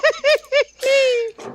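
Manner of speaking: high giggly laugh; tipsy burst of giggles. Real, not acted. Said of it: "I'm a little bit tipsy".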